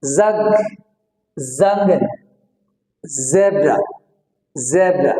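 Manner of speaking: "জগ", "জঙ্গল", "জেব্রা" each begun on a held z; word-by-word drill, buzzing the z in "জেব্রা"; buzzed z onset. Shown as a demonstration. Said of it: "এটা sound হলো". (z ধ্বনিতে "জগ", "জঙ্গল", "জেব্রা")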